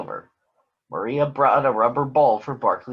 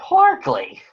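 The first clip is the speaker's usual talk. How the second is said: loud dismayed call of the name; cried out; 3 semitones higher, 7 dB louder than usual. A narrator's reading. Big Bird finds the chewed glove, loud dismayed call. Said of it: "Big Bird cried".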